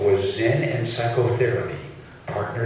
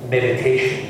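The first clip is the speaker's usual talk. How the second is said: ordinary delivery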